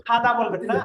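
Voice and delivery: usual speaking voice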